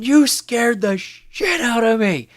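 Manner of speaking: high, slow, hammed-up scare; over-embellished fright. Gamer replaying his hammed-up shriek for the lobby. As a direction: ham up being scared for laughs. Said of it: "over embellishing and going".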